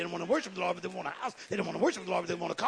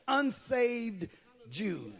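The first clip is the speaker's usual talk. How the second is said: normal talking voice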